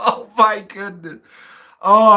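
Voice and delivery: slow words through laughter; breaking up with laughter; laughing to tears. Real, not acted. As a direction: get it out while still laughing. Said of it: "I'm gonna start crying here".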